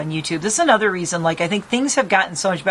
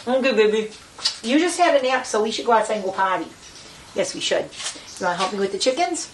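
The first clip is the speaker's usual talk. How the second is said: higher sing-song pet voice; pet talk; 5 semitones higher than usual. Real, not acted. Owner telling Enid they go outside, pet talk.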